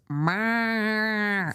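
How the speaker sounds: a cat's meow with a giggle; laughing cat meow. Retold or imitated. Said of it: "like that".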